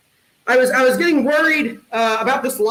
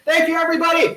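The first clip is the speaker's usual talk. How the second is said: high-pitched thanks to the crowd; raised showman's farewell; calling out sign-off; 6 semitones higher, faster than usual. Real, not acted. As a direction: call out to the whole audience as you close. Comic signs off to the audience.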